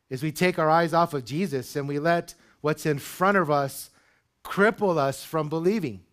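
high, loud, driving preacher's build; preaching at full force. Acted. Pastor rousing his congregation, preaching at full force. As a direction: lift the room, pushing each line harder.

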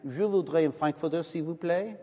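timid American trying French at a hot dog stand; poor dinner table French. Retold or imitated. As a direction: put on clumsy tourist French, shy and unsure. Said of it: "in my very poor dinner table French".

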